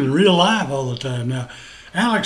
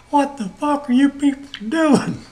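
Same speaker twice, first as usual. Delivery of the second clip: higher, exasperated plea; imitated near-crying; 7 semitones higher than usual. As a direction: imitate a man about to crack up in disbelief. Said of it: "He's practically crying. He's going".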